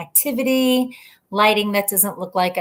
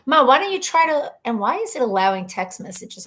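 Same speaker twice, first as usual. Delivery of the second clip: ordinary delivery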